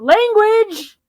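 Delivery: high, loud scolding yelp; kobold shout. Acted. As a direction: snap it out like a scolding creature. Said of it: "My kobold's gonna shout".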